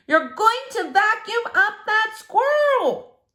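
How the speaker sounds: hearing her own shout again; echoed shout. Acted. Repeating the shouted line.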